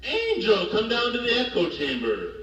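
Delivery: high sing-song call into an echo chamber. Acted. Host calling a guest into the echo chamber, high sing-song call.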